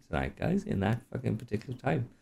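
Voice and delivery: ordinary talk, nothing marked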